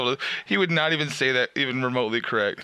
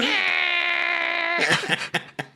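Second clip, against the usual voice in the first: high squealing laugh, real; high shrieking laugh. Real, not acted. Laughing at reverse ibuprofen.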